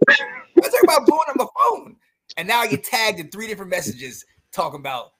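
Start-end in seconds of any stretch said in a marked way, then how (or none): 0.1-2.0 high, baffled question; surprised. Retold or imitated. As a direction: act startled by all the calls. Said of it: "my phone is blowing up".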